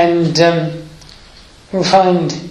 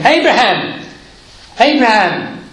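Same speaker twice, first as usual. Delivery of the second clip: calling out; 5 semitones higher than usual. Acted. Angel calls out from heaven.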